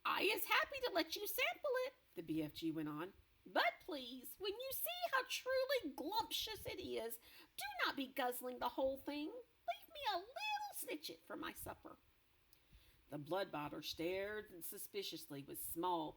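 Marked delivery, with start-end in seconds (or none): 0.0-12.0 BFG voice. Acted.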